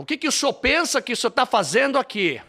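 high, loud angry scolding; furious bride. Retold or imitated. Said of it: "ela vem toda brava".

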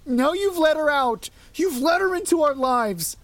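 high, loud sobbing wail. A narrator's reading. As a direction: cry it out in panic. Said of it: "she sobbed".

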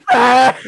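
high, loud imitated scream; mock scream of agony; pained scream. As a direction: cry out high and loud as if in major pain. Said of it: "He was having major pain".

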